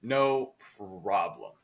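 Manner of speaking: stretched out very slowly for emphasis; slow, emphatic repeat; drawn-out emphasis. Real, not acted. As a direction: linger on each word, leaning into it.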